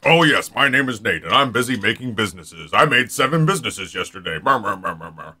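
low, soft busy businessman voice; Nate impression. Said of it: "That's what you sound like".

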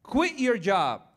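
blunt advice. Retold or imitated. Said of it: "you know what I said to her".

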